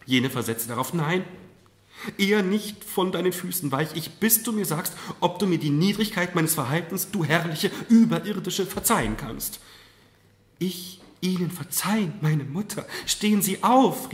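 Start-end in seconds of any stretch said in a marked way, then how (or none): 0.9-9.6 high, loud, fervent vow; impassioned pleading. A narrator's reading. Kneeling mother begs forgiveness. 10.6-14.1 high appeal, building to loud; imploring cry. A narrator's reading. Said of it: "rief die Marquise".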